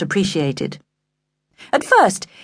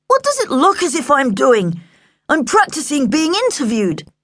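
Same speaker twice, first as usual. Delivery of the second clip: indignant retort; 8 semitones higher than usual. A narrator's reading. Embarrassed son retorts to dad.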